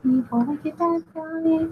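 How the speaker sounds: slow and high, playing at menace; mock villain voice. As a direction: ham up the villain's name. Repeats villain name, theatrical.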